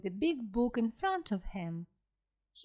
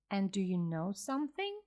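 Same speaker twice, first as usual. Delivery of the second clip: usual speaking voice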